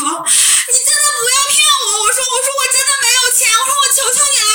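high, pleading voice; retold pleading. Student begging the scammer again, high pleading. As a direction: beg him not to cheat you.

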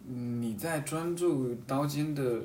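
ordinary talk

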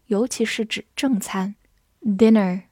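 ordinary talk, nothing marked

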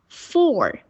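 drawn-out "for", full r-coloured "or"; stressed "or" sound. Shown as a demonstration. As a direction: say "for" slowly with a held OR vowel. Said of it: "一定是在重读音节中才会发or".

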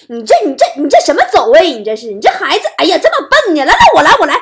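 loud, worked-up backseat gaming; mock-exasperated parent itching to take over; scolding gamer parent. Parent watching a child's game play, loud exasperation. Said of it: "但我们会这样说".

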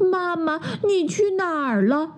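a small cub who has been crying; tearful cub. Acted. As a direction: cub tearfully asks mum where she went. Tearful cub asks mother.

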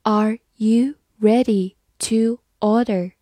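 "Are you ready to order?" slowly, word by word; slow reading. Shown as a demonstration. Teacher reads "Are you ready to order?" for learners, slow reading. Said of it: "慢慢来读".

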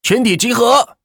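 high, loud drill call; shout. Acted. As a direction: call the troops to assemble. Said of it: "拿起脖子上的口哨吹道".

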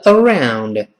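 around said slowly with a curled r; careful around. Shown as a demonstration. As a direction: stress the r in around. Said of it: "注意这个发音".

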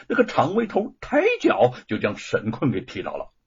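ordinary delivery, nothing marked

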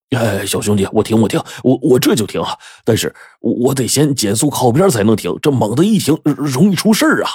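hurried, stammering appeasement, climbing in pitch; flustered placating. A narrator's reading. Bus driver stalling robbers who demand he stop, flustered.

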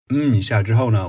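normal talking voice

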